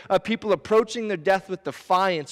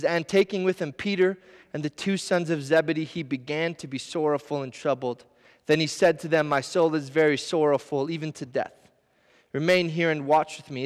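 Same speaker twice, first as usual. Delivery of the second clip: scripture reading; 4 semitones lower than usual. Acted. Reads verse thirty-seven.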